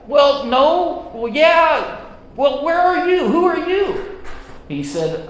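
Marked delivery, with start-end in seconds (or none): 0.1-4.2 much higher, louder, frantic questions; a hammed-up frightened man calling up; panicked questions. Hanging man asks who speaks.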